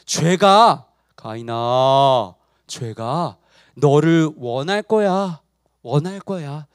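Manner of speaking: low, slow divine warning; God warning. Acted. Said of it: "이렇게 말씀하셨어요". (낮고 느리게 경고하는 하나님 연기)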